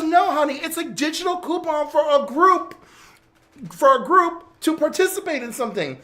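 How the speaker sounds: high, loud mock exasperation; exasperated explaining. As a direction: spell it out impatiently, imitating a fed-up friend. Retold exasperated explanation to friend.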